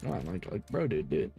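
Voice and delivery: ordinary talk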